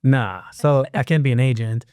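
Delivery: ordinary delivery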